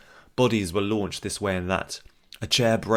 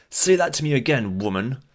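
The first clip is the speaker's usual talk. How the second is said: angry threat; 6 semitones higher, 5 dB louder than usual. Acted. Sagar threatening with drawn blade.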